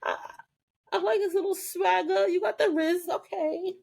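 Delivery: high, breathless mock flustered okays; flustered Prue. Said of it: "Prue was just like".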